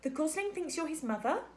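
put-on high-pitched young beaver voice; quiet young beaver. A narrator's reading. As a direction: ask it as a curious beaver child. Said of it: "came a quiet voice".

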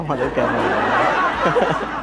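slow, low, laughing through it. Real, not acted. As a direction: giggle as the prank is revealed. Prank reveal, laughing. (हँसते हुए धीरे से)

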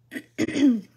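loud, sharp throat clear; clearing a tickly throat mid-sentence. Real, not acted. Crafter apologizing to viewers for being coughy, loud throat clear. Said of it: "a little bit coughy today".

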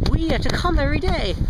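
high-pitched, swooping complaint; exasperated, pitched well up; emphatic complaint. Real, not acted. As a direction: push it up high with indignant emphasis. First take, then corrected as unintended.

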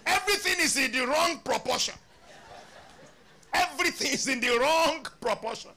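high, loud preaching; full-force preacher's rebuke. Acted. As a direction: thunder the rebuke from the pulpit.

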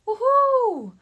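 high, loud, held "woohoo" cheer; excited woohoo. Real, not acted. Streamer cheering the tour dates, high loud whoop. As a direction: whoop it out for the fans.

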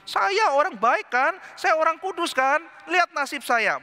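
high, slow whining victim; whiny self pity. Retold or imitated. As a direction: whine it like a sorry victim. (merengek mengasihani diri)

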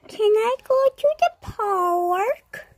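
put-on high, slow kid's plea; pleading kid. Child begging mommy to go to the park, high and slow. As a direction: beg like a little child.

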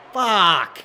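loud, high burst of swearing; frustrated shout. Real, not acted. Player cursing after giving up a run, frustrated shout. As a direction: shout the curse in one blast.

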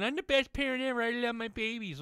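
high, sing-song mock voice; mocking parent boast. Mocking the cousin's Facebook bragging, high and sing-song. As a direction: parody a smug mother bragging online.

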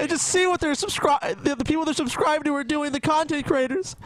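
very high, mock-distraught plea; getting emotional. Telling Paul what subscribers want, mock emotional. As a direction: plead for the poor viewers, hammed-up and high. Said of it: "getting a little emotional".

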